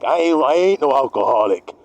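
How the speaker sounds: lightly voiced drunk denying it; a hint of a slurring drunk; defensive drunk. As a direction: play the drunk who swears he's fine. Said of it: "The alcoholic".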